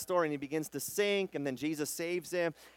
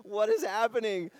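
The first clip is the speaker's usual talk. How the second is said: exasperated Jesus; 2 semitones higher than usual. Retold or imitated. Jesus rubbing his eyes at his disciples, exasperated. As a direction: sigh it out, then throw up your hands. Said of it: "Jesus rubbing his eyes".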